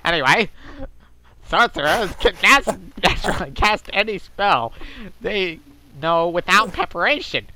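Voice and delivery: pinched, nasal, with the nostrils held shut; nose-plugged voice. Acted. Reading Mister Happy's post aloud while holding his nose. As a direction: read it holding your nose like a smug poster. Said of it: "I should be plugging my nose".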